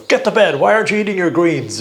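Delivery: imitating a parent ordering the kids to bed; nagging parent. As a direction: bark it like a parent at bedtime. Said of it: "I just sound like my parents".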